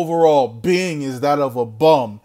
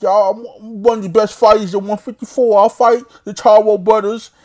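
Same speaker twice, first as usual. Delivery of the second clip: put-on little-baby lisp, pitched up; mock him with higher, mushy baby talk; Demetrius Andrade; 4 semitones higher, 3 dB louder than usual. Imitating the boxer boasting about his fights, babyish lisp. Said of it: "talking like a little baby".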